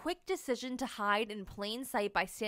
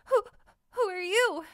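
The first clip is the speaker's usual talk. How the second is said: high, stammering and timid; scared stammer; 9 semitones higher, 5 dB louder than usual. Acted. Lost marshmallow nervously asking the stranger.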